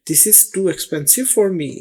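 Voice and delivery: very slow and carefully spaced; slow model sentence. Shown as a demonstration. Teacher modelling a shopping phrase slowly for students. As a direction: spell out each word slowly for learners. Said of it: "teaching shopping phrase".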